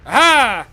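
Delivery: loud gotcha aha; triumphant aha. Real, not acted. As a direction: bark out a victorious aha. Catching enemy in game.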